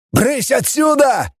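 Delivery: high, drawn-out scream; yelling. A narrator's reading. Man orders everyone out of the gallery, frantic yell. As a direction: shoo them away urgently. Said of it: "завопил Зегерс".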